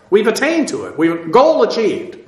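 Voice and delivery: normal talking voice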